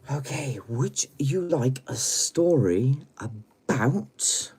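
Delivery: slow, high, swooping lilt; singsong, slow invitation; storyteller's teasing offer. Pretend. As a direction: draw it out with rising suspense.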